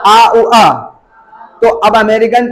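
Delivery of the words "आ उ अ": आ उ अ as three joined vowels; teacher sounding out the vowels in आ उ अ; triphthong. Shown as a demonstration. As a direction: glide through आ उ अ, each part audible. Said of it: "इसको बोलेंगे ट्रिप्थोंग्स".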